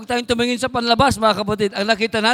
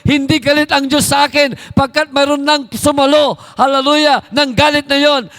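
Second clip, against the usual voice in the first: loud, higher fervent preaching; preaching at full force; 6 semitones higher, 9 dB louder than usual. Acted. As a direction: drive the message home to the congregation. Preaching climax, louder over music. (malakas at maalab na pangangaral)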